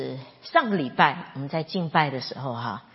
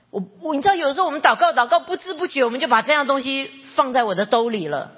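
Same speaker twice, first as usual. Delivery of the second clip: higher, louder preacher's push; emphatic preaching; 8 semitones higher, 6 dB louder, faster than usual. Real, not acted. Pastor pressing the congregation about prayer, loud and high. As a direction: drive the point home.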